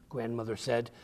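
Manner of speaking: ordinary delivery, nothing marked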